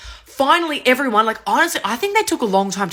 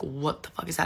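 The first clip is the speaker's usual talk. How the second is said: much softer, dropped-voice question; uneasy self-talk; 5 semitones lower, 12 dB quieter than usual. Retold or imitated. Girl to herself on seeing static in the dark, uneasy and quiet. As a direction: say it under your breath, unsure.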